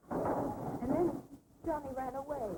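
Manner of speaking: ordinary talk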